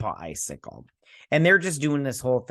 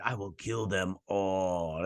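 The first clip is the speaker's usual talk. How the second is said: lower, softer, slow menacing voice; a hammed-up movie-monster threat; mock villain; 4 semitones lower, 6 dB quieter, slower than usual. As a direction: Ultra Humanite threatens them, low slow menace. Villain Ultra Humanite speaks.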